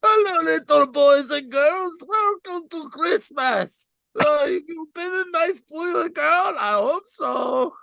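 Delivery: strained, high foreign-accented Santa voice; Santa Claus. Shown as a demonstration. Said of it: "And here's Santa, ready?".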